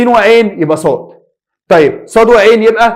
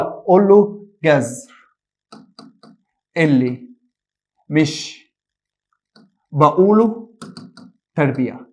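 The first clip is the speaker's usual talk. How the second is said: slow dictation; 3 semitones lower, 5 dB quieter, slower than usual. Shown as a demonstration. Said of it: "teacher dictates the rule slowly".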